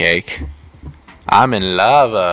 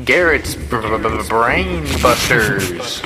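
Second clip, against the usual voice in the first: mock show title; slower than usual.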